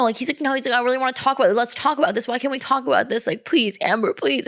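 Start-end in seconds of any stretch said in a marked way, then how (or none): 0.4-0.5 mildly whined imitation; mock his whiny no; whiny refusal. Man refusing to leave the doorway, imitated as mildly whiny. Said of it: "this whiny ass fucking voice". 0.7-4.5 higher-pitched mock pleading; whiny voice. Man begging in the doorway to talk about it, imitated as whiny. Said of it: "this whiny ass fucking voice".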